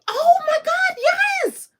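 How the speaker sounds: high loud crying shout of joy; joyful shout. Real, not acted. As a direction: scream with happy disbelief. Overjoyed shout.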